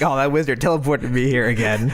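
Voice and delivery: lightly acted complaint through laughter; mock grumble while laughing; half-laughing put-on excuse; Fanny. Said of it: "And Fanny's like".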